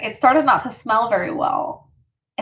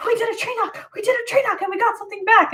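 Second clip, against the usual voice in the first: high, fast excited retelling; the little sister blurting it out, pitched up; breathless sister; 10 semitones higher, faster than usual. Retold or imitated. Imitating her sister telling mom about the tree knock, high and fast. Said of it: "And she was like".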